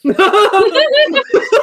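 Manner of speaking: high, loud burst of laughter. Real, not acted.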